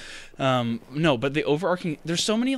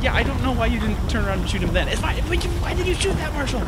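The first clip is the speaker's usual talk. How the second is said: agitated; 9 semitones higher, 6 dB louder than usual. Pretend. Pretend panic in game.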